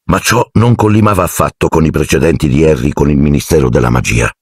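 ordinary delivery

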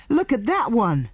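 put-on high, alarmed child's cry; children's yell. A narrator's reading. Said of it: "the children gave a yell".

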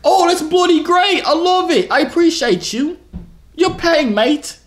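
high, slow mock British accent. YouTuber asks strangers if his accent is good, mock British. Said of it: "Is my accent good?".

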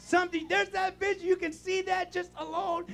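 loud, high, driving preacher's cry; full-force preaching. Acted. Pastor preaching hard to the congregation, full force. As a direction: preach it out at the top of the lungs.

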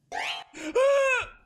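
huge, high, loud gasp; terrified gasp. Real, not acted. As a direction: recoil from the scare. Jumpscare in horror game.

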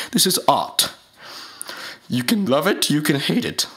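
ordinary delivery

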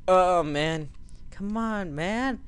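ordinary talk

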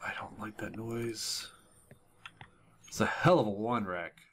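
hushed, tense whisper; nervous whisper. Real, not acted. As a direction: whisper it so nothing hears you. Hiding in a scary game.

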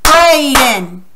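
"Brayden" in two loud, separate beats; syllable by syllable. Shown as a demonstration. Teacher clapping the pupil's name, "Brayden" split into two beats. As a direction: punch both syllables of "Brayden". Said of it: "clap your name".

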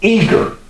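eager lifted high and punched; stress on eager, pitched high. Shown as a demonstration. Preacher driving home the word eager, high and emphatic. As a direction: hit eager up high, with force. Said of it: "I want to emphasize the word eager".

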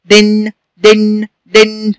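short i in دن, then hum the n; kasra tanween. Shown as a demonstration. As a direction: say دن slowly as "din" with a held n. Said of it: "صوت نون ساكنة بعد الدال المكسورة". (نطق دن "دِنْ" بنون ساكنة)

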